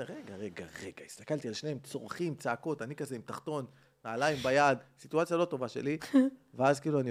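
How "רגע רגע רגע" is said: much softer, unsure wait; stoned guest nervously halting the shouting couple, soft; hesitant interruption. Retold or imitated. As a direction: cut in timidly, paranoid. Paranoid narrator stopping the fight.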